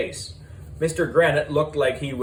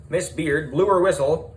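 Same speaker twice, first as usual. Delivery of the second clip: ordinary delivery, nothing marked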